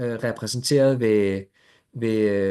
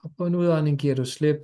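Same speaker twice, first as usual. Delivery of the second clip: guided meditation. Acted. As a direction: ease them gently into stillness.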